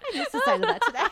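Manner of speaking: giggling out the line; laughing. Real, not acted. Host laughing at her own Your Grace plan.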